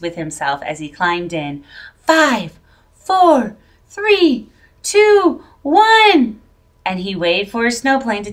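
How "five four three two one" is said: rising higher and louder with each number; eager countdown. A narrator's reading. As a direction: build the countdown to blast-off. Countdown for snowplane takeoff.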